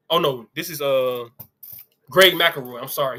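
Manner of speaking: ordinary talk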